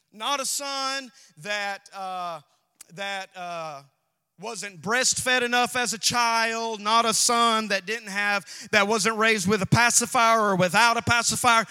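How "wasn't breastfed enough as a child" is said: ordinary talk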